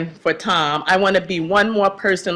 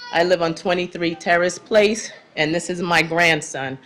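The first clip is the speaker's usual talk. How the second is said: ordinary delivery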